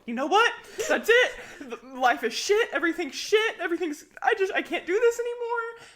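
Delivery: hammed-up loud, high despairing rant; mock outburst. Retelling her own spiral, a loud high mock meltdown. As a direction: play up the sudden meltdown.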